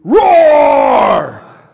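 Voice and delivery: huge loud high roar; lion roar. Shown as a demonstration. Pastor leads the congregation, roaring like a lion. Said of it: "I want you to roar".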